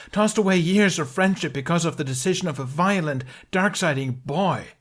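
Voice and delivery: high, loud furious tirade. A narrator's reading. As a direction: let the anger explode. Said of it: "fuse snapped away in flames".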